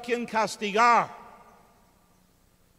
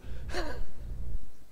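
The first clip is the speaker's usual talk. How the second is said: short laugh; 10 semitones higher than usual. Real, not acted. Laugh at grim joke.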